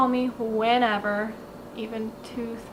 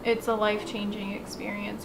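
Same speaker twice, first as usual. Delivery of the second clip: usual speaking voice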